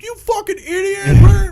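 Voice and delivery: very high, drawn-out scream; hammed-up yell while falling away; mock furious yell. Man falling off the boat, mock furious yell.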